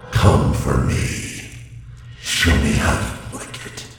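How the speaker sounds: loud booming demon voice. Acted. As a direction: roar it out like a demon. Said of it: "You wanna hear my demon voice?".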